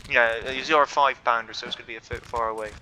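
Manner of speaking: usual speaking voice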